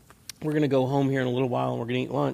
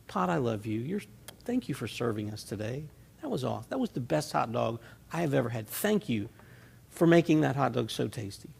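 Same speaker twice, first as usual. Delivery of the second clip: softer, tender thank-you; tender thanks to pot; 4 semitones lower, 6 dB quieter than usual. Pretend. Preacher thanks the pot after lunch, softly tender. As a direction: murmur thanks like kissing a pot clean. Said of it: "we kiss the pot".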